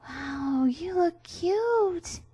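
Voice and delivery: high, slow, breathless awe; awed Meta Knight. Acted. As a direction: gush it in wonder. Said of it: "he said in awe".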